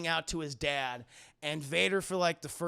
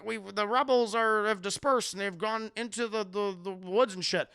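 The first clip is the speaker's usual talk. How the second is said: Han; 4 semitones higher, 7 dB louder than usual. Retold or imitated. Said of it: "he's like".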